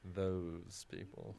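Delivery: slow, drawn-out mock disdain; that disdainful tone. Shown as a demonstration. Co-host echoing the othering phrase, slow mock contempt. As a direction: stretch it out with put-on contempt. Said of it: "you have to say it in that tone".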